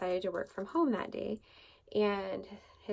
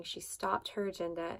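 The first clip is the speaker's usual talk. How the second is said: ordinary talk, nothing marked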